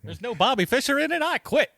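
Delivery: high-pitched mock protest; jokey, high-pitched refusal; mock indignant quitter. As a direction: quit in a high, put-on huff. Riffs as the kid quitting.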